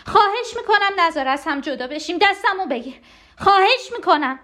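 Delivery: high, urgent begging; desperate pleading. Acted. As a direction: beg as if slipping from their grasp. Begs not to be separated. (التماس درمانده با صدای زیر)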